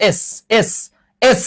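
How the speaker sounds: "এস" sounded out with its opening vowel; teacherly sounding-out of "এস"; pronunciation demo. Shown as a demonstration. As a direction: repeat "এস" separately, showing the vowel start. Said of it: "উচ্চারণটা কি".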